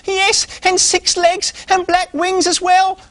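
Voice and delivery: very high, slow mock-scary pig voice; little pig scaring the squirrel, slow and very high; spooky description. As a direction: spin the scary tale slowly. Pig building the monster tale.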